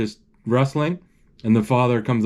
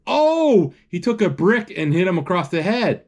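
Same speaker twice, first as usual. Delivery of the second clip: shocked; 6 semitones higher than usual. Real, not acted. Shocked by the brick hit.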